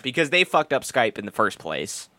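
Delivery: ordinary talk, nothing marked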